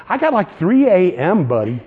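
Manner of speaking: ordinary delivery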